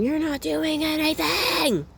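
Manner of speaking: high, drawn-out screechy ego voice; mock scream. The ego protesting to someone seeking rest, high mock scream. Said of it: "the ego's gonna scream".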